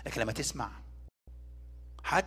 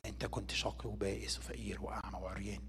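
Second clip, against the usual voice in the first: soft grave whisper; a hushed, solemn whisper; whispered verdict; 8 semitones lower, 13 dB quieter than usual. Retold or imitated. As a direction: breathe the judgement almost silently. Quoting God's final verdict, whispered.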